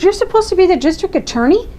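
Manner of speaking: high, sharp, indignant cut-in; angry outburst. Retold or imitated. Mother cutting off the district attorney in court, angry high voice. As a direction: stop him short, outraged. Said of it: "so frustrated and so angry".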